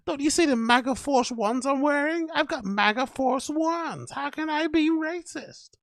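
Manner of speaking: high put-on plea of innocence; mock excuse-making. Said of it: "giving you one of those ones".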